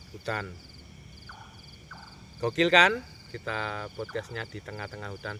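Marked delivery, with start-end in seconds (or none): 2.4-3.0 excited. Real, not acted. Hyping the jungle podcast setting.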